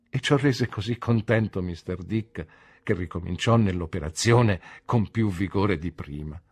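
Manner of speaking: usual speaking voice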